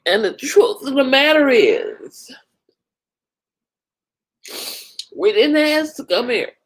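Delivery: real tearful voice, pitched high; in tears. Real, not acted. Overwhelmed streamer grieving what they're going through, in tears. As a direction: choke back tears between phrases. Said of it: "I'm in tears thinking about it".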